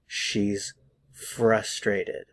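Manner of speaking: "She's ... frustrated", slow with a pause between the words. Shown as a demonstration. Teacher models "She's frustrated" for learners, slow and word by word. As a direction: stretch "She's", pause, then "frustrated" slowly. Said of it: "pay attention".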